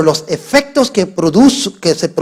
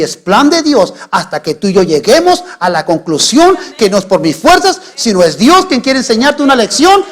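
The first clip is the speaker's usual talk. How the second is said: impassioned sermon climax, building higher and louder; full-force preaching; 7 semitones higher, 5 dB louder than usual. Real, not acted. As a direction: build to a high, loud shout.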